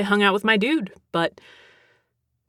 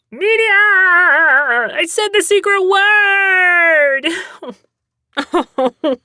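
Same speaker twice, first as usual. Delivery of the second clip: high, loud and slow, dragged out with glee; excited; 11 semitones higher, 7 dB louder than usual. Pretend. Announcing the secret word to viewers, excited. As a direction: crow it like a game-show host.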